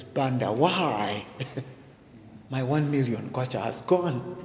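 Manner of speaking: a hint of fake weeping over the money; mock crying. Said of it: "And now he's crying".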